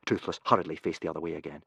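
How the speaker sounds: plain reading voice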